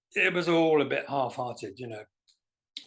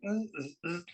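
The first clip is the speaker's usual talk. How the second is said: robot grunts; 3 semitones higher, 7 dB quieter than usual. Pretend. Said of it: "stand there going".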